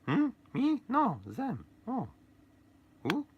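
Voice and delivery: very high, squeaky bickering voice; a tiny toy brother picking a fight, high-pitched; high squeaky voice of a quarrelsome toy; squeaky brothers' voice. Acted. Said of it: "scripted story between Fredo and Giuseppe".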